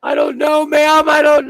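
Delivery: high, loud protest; loud defensive whine. Real, not acted. Shouting back at his friend's scolding, high and loud.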